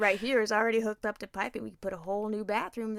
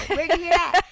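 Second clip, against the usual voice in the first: high, loud exasperated rant; hammed-up parent losing patience over reading; frustrated; 8 semitones higher, 8 dB louder than usual. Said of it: "she can see I'm frustrated".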